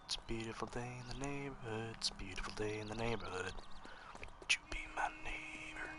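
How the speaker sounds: soft, hushed and creepy; creepy quiet. Shown as a demonstration. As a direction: murmur it creepily. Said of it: "being creepy".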